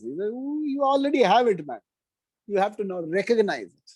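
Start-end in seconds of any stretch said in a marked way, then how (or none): 0.3-1.8 higher-pitched playful "Ooh", no real laugh; lightly voiced teasing, pitched up; giggling God. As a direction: act out an amused God. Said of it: "God giggles".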